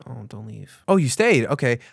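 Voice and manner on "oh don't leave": very soft, low little plea; mock dejected plea. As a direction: shrink to a faint, pretend-forlorn murmur. Pretends the viewer has left.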